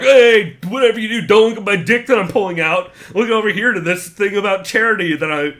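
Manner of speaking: high, loud mock huckster pitch; mocking Conor. Mocking Conor hiding his stunts, loud mock barker. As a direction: call out like a carnival barker distracting a crowd.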